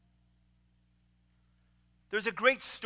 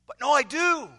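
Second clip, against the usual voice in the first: booming, much higher and louder hearty greeting; burly pastor; 6 semitones higher, 6 dB louder than usual. Retold or imitated. Burly pastor greeting the student at the door, booming and hearty. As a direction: boom it out like a jolly big pastor. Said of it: "says the pastor".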